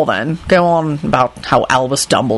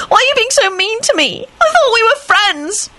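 much higher, bawling plea; tearful high wail. A narrator's reading. Rosie bawling at Lupin, high tearful wail. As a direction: wail it through tears.

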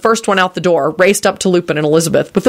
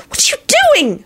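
very high shrieked question. A narrator's reading. Elizabeth alarmed at Lupin's wand, shrieking. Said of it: "she shrieked".

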